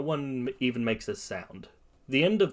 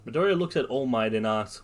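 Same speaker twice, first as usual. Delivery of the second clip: plain reading voice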